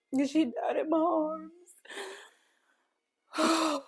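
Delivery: voice breaking. Real, not acted. Mother repeating to viewers that her baby died, voice breaking. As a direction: let it break as the tears come.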